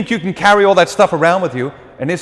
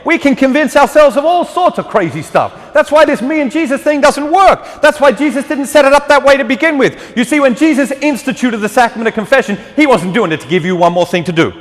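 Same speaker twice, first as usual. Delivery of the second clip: fervent preaching; 8 semitones higher than usual. Acted. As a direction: preach it out to the whole church. Raised preaching passage.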